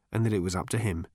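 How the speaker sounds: usual speaking voice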